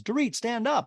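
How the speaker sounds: high mimicked command; Garcelle impression. Garcelle telling Dorit to stand, high put-on voice. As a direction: pitch the command up. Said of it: "and Garcelle goes".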